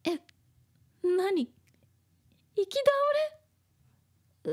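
high, loud startled question; sudden startled "what?"; shocked. Acted. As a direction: gasp it out in shock. Acting shocked discovery.

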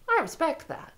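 ordinary delivery

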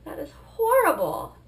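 slow, high, loud disgust; horrified. Real, not acted. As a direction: draw it out in horror. Horrified by side effect.

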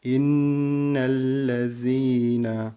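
İnnellezîne with the doubled n held long, very slow; slow recited İnnellezîne, long held nn; held nun. Shown as a demonstration. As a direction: hold the nn of İnnellezîne, slow chant. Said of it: "nun harfinde tutmayı yaptık".

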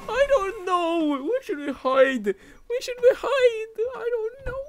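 high, whining fake cry; mock whimper. Gamer faking a frightened whimper, high and crying. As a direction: blubber like a scared kid.

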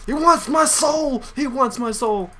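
high, drawn-out cry of alarm; alarmed wail. Real, not acted. Frightened cry about game enemy.

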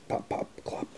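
whisper. Acted. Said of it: "let's review".

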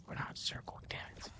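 breathy whispered curse under the breath. Real, not acted. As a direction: hiss it out, barely voiced. Sudden whispered curse at game.

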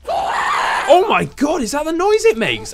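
real startled shriek; startled shout. Real, not acted. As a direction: shout in alarm at the sound. Startled by the ghast noise.

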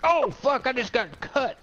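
high sudden outburst on getting hit; startled. Real, not acted. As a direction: yelp as the hit lands. Gets hit in game.

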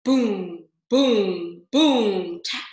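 loud booming drum imitation; drumbeat. A narrator's reading. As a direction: boom like a drum. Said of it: "went the drum".

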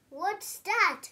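ordinary delivery

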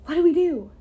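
ordinary delivery, nothing marked

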